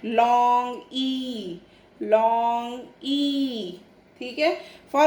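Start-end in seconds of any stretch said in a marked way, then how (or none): 0.0-3.8 long ई said very slowly, the ई held long. Shown as a demonstration. Teacher showing children the long ee sound, held long. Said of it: "तब इसकी sound बनती है ई".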